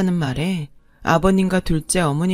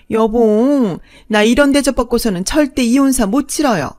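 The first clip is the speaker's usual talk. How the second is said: higher and louder, indignant whining; shrill outrage; 4 semitones higher, 5 dB louder than usual. Acted. Second wife scolding the bride and whining to her husband, outraged. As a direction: throw a fit and appeal to her man.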